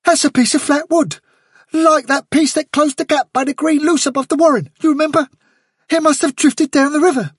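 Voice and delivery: a clever rabbit rushing out his idea; excited inventor rabbit; Blackberry's quick voice. A narrator's reading. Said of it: "he said quickly".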